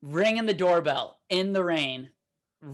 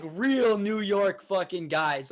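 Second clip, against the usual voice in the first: normal talking voice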